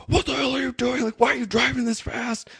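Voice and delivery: high-pitched mock outrage; angry driver impression. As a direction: imitate an irate driver telling someone off. Retold angry driver confronting him.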